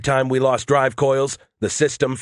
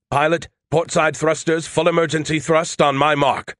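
higher-pitched, pressing order; urgent command; 4 semitones higher than usual. Acted. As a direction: give the pilot an emergency order. Urgent order to pilot in battle.

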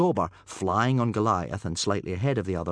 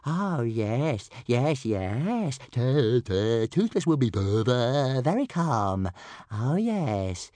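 higher, stuttering creature voice; eager little dragon; 5 semitones higher, 3 dB louder, much slower than usual. A narrator's reading. Toothless assuring Hiccup he will be calm, eager. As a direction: agree eagerly, stammering like a small dragon. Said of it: "He nodded eagerly".

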